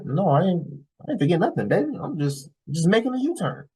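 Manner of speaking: high, singsong mock-innocent reply; feigned innocence. Boyfriend answering girlfriend while making a U-turn, mock innocent.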